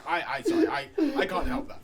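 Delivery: sorry said through a real laugh; laughing apology. Real, not acted. Call center worker laughs through an apology to a customer. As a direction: laugh while apologizing to the caller.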